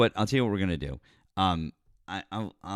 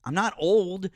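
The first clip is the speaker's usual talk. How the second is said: high, louder, defensive outburst; indignant protest; 12 semitones higher, 6 dB louder than usual. Real, not acted. Host defending her age to co-streamers, high indignant protest.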